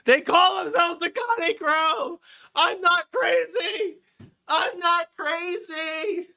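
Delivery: very high, slow, drawn-out hysterical cry; mock-crazed wail. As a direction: stretch each word at the top of your voice. Mock-crazed repeat of the rant.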